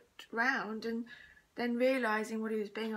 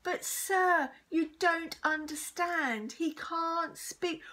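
high, slow, urgent plea; pleading protest; 7 semitones higher, 5 dB louder, much slower than usual. A narrator's reading. Pupil defends new boy to teacher, pleading.

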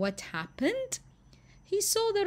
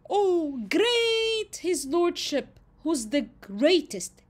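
high, fawning, louder praise; butter him up, gushing; flattering; 8 semitones higher, 5 dB louder, slower than usual. Acted. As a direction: hare flattering the vain lion. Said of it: "He played on how the lion viewed himself".

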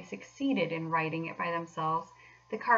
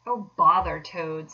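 louder, slow irritated outburst; savage dismissal; 8 dB louder, slower than usual. A narrator's reading. Rat sick of Toad, savage. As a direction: throw it out crossly, fed up. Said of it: "said the Rat savagely".

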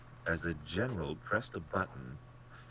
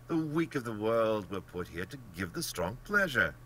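higher, emphatic voice of an arrogant hunter; imperious, contemptuous general, raised pitch; haughty general; 7 semitones higher, 5 dB louder than usual. Acted. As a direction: declaim your creed as the ruthless general.

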